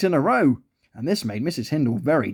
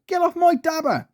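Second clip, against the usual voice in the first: high-pitched indignant cry; high, frantic storybook yell; 13 semitones higher than usual. A narrator's reading. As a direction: shoo it away in a panic. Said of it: "she cried".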